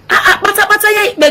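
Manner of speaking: exasperated outcry. Real, not acted. Exasperated outcry over election.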